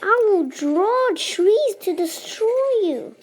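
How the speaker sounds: high, swooping playful menace; mock villain threat. Player threatening the game's enemy, mock villain threat. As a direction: proclaim it grandly with swoops in pitch.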